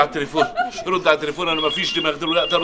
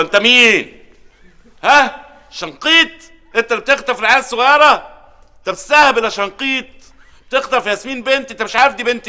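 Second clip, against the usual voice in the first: threatening caller; 6 semitones higher than usual. Acted. Confronting kidnapper on phone.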